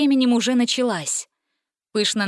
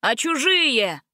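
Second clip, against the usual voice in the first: mild heckle; 4 semitones higher, slower than usual. A narrator's reading. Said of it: "снова крикнул кто-то".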